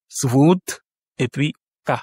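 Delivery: "Svod", a pause, then "K" as "ka"; syllable by syllable. Shown as a demonstration. Teacher counts two syllables, syllable by syllable. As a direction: split Svod and K into two beats. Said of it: "donc deux syllabes".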